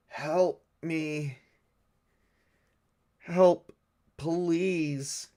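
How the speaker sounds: high pleading calls for help; pleading cry. Acted. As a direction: beg weakly for help, up high. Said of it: "they hear".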